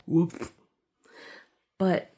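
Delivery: ordinary talk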